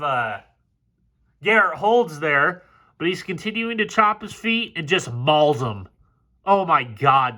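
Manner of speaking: usual speaking voice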